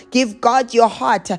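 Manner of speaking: usual speaking voice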